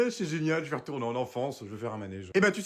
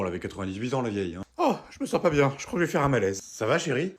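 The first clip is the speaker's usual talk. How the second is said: ordinary delivery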